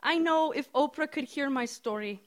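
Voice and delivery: put-on hopeful Indian accent, high and loud. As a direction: dream aloud in an Indian accent. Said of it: "she says in her strong Indian accent".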